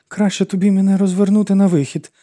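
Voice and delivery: high, plaintive wailing plea; pleading, self-pitying high whine; pitiful wail. A narrator's reading. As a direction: wail it out, feeling sorry for yourself. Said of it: "жалісливо завила вона".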